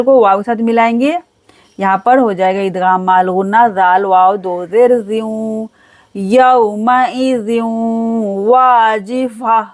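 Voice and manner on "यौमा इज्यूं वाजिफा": yauma izyun wajifa drawn out, wa stretched; slow recitation. Shown as a demonstration. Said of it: "अलिफ के साथ मिलाकर खींचा जाएगा".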